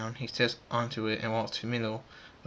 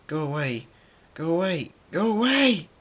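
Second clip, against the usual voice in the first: building from raised voice to high shout; desperate plea; 7 semitones higher, 6 dB louder than usual. Acted. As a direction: repeat it louder and higher each time, ending in a shout. Thunderstorm rejects the dangerous power.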